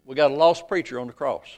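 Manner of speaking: ordinary talk, nothing marked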